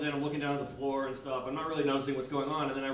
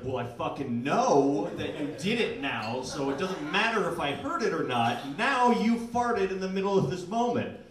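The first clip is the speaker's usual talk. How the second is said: rising louder, incredulous; exasperated rant; 3 semitones higher, 6 dB louder than usual. Retold or imitated. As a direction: build from disbelief to loud outrage. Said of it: "I was like".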